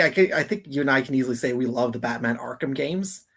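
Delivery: ordinary talk, nothing marked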